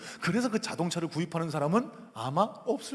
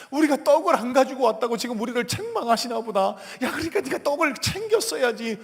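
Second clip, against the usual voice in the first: loud, high-pitched acted grumbling; reacting disciples; 8 semitones higher, 8 dB louder than usual. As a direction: blame your companion out loud. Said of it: "이렇게 제자들이 반응할 때".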